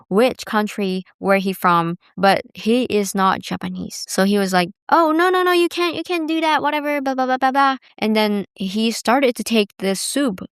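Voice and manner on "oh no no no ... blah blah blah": high-pitched waiter imitation, running on into blah-blahs; flustered refusal. Retold waiter refusing the request.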